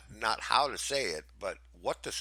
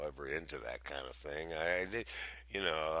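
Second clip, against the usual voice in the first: ordinary delivery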